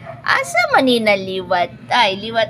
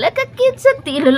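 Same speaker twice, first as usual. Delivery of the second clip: much higher squeal over cute paws; gushing; 13 semitones higher than usual. Real, not acted. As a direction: squeal with delight at the tiny feet. (taas nga tingog nga nalipay sa gamay nga tiil)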